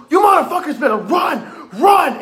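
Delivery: high, loud acted yell of warning; urgent shout. Clown guide to the group in a chase, urgent shout. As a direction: mimic a guide yelling at the group to flee.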